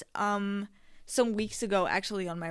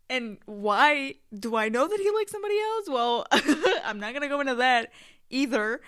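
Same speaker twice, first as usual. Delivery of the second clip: laughing; 3 semitones higher, 5 dB louder than usual. Real, not acted. Laughing while teasing the listener.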